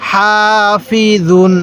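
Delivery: slow, syllable by syllable হাসিদিন; slow recitation. Shown as a demonstration. Teacher reading the word slowly for students to copy. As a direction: draw each syllable out carefully. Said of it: "আরেকবার".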